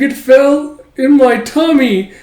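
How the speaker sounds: put-on high, loud, slow whimpering kid; sulky child. Son complaining to his father after being smacked, high sulky child. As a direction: complain like a hurt little boy. Son admits swallowing candy.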